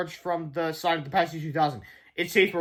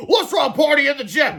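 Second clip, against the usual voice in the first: high, loud party cheer; shouted; 9 semitones higher, 8 dB louder than usual. A narrator's reading. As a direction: shout it in celebration. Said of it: "shouted Mister Meter".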